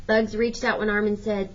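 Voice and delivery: ordinary delivery, nothing marked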